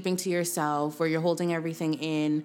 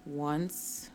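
guided meditation. Acted. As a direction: guide them gently through each breath. Leads a breathing meditation.

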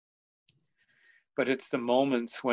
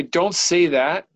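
loud, slow, higher mock dismissal; dismissive brush-off; 5 semitones higher, 7 dB louder, slower than usual. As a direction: imitate someone brushing the feeling aside. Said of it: "so I don't automatically say".

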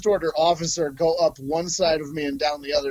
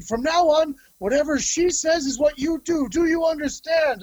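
barking officer; 11 semitones higher than usual. Retold or imitated. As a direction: shout the order much higher, like a hammed-up officer. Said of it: "And he's like".

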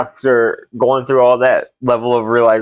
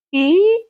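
high, thin squeak; imitated chair creak. Storyteller mimics the chair creaking under him, high squeak. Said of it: "not supposed to make that noise".

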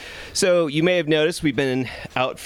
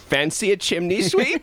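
bad Cockney accent; 9 semitones higher than usual. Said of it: "terrible Cockney accent".

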